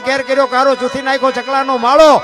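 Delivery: loud, high, driving chant of folk verse; folk verse recitation. Acted. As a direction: declaim it like a folk bard, line after line at full force. (જોશભેર ઊંચા અવાજે લોકકાવ્યનું પઠન)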